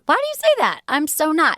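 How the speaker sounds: high-pitched, put-on innocent protest; mock protest. Woman answers cute French guy's teasing, high mock protest. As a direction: squeal a playful denial. Retold coy reply to French guy.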